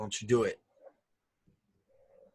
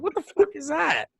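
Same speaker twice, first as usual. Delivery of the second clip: mock Sasha; 13 semitones higher than usual. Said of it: "Sasha's like".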